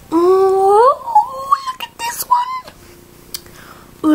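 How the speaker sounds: very high, slow, delighted squeal; excited. Real, not acted. Gushing squeal at her toy for viewers. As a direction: coo over it, stretching the words high.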